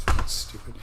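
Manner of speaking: laughing it off under his breath; a quick embarrassed laugh; breathy self-laugh. Real, not acted. Laughing at himself.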